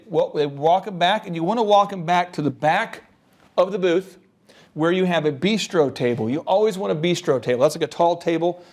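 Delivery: ordinary talk, nothing marked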